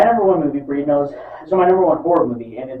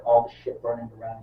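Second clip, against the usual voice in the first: ordinary delivery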